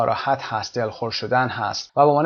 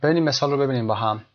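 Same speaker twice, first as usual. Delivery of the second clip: usual speaking voice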